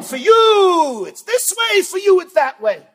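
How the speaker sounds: a judge calling out favors, mocking; mock partial judge. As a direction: hammer each for you like a crooked judge.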